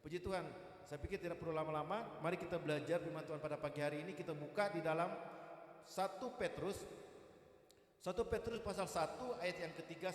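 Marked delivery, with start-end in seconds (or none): none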